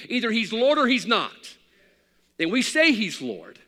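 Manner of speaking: loud, high preaching cadence; fiery sermon. Acted. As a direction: preach it hard to the congregation. Preaching at full force.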